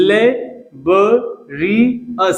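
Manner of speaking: laborious drawn out very slowly; slow model word. Shown as a demonstration. Teacher to students, modelling laborious very slowly. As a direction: stretch laborious slowly for the class. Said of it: "तो laborious आपका use करें".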